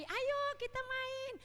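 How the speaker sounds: put-on high, slow, sweet little-kid invite; child's voice. Child asks yesterday's rival to play again, sweetly. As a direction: coax a friend like a small child.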